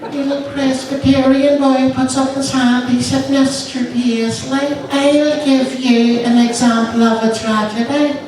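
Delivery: put-on little boy voice. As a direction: pipe up like a small boy. Child's voice in joke.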